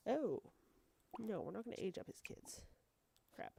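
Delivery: ordinary talk, nothing marked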